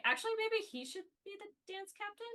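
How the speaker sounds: high, put-on voice of the members; mock bandmates. Imitating the members demoting the dance captain in a high put-on voice. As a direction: play the guys ribbing him. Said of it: "the guys were like".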